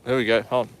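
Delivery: normal talking voice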